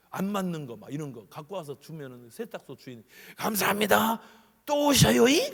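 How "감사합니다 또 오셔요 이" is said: high, loud bright greeting; laundry owner thanking a customer loudly; cheerful shopkeeper. Retold or imitated. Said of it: "그런다니까".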